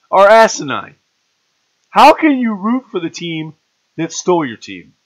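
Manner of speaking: high, loud, exasperated rant at fans; heated rant. Real, not acted. Lecturing Browns fans on rooting for Baltimore, a loud rant. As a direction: lay into them, voice climbing and loud. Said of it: "So it's rant time".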